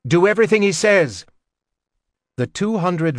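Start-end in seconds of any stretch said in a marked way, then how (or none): 0.1-1.3 loud, fast command; shouting. A narrator's reading. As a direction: hurry it out as a yell. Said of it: "Logan shouted".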